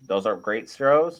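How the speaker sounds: ordinary talk, nothing marked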